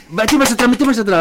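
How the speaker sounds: high, loud, hurried orders; urgent call to back out; urgent getaway. Retold or imitated. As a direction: rush the driver to reverse.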